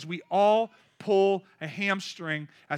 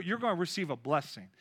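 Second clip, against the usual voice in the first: normal talking voice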